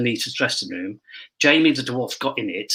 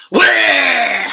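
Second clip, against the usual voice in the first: loud, low barked wah; mock boo shout; 10 semitones lower, 8 dB louder than usual. Dwarf leaping out of the box at the actress, loud. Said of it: "he came out and went".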